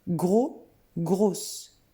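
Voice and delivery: "Gros" with silent s, "grosse" with sounded s; teacher contrasting "Gros" and "grosse" by the final s; changed pronunciation. Shown as a demonstration. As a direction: model "Gros", then "grosse" with a clear final s. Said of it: "ça change la prononciation".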